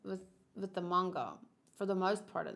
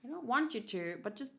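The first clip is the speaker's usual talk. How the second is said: ordinary delivery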